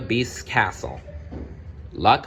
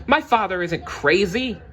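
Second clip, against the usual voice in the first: cried out; 12 semitones higher than usual. Acted. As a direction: cry out in protest. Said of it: "Belle cried".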